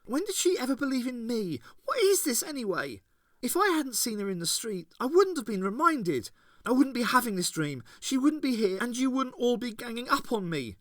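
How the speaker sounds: put-on high, loud girl voice in an outburst; exploding. A narrator's reading. Ace lashing out at her mother's allies, angry. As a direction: Ace bursting out at them all. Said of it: "exploded Ace".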